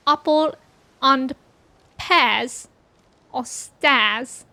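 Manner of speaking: "pears" and "stairs" said without the r, posh British; hammed-up posh English accent; mock posh British. Shown as a demonstration. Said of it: "How do posh people say it?".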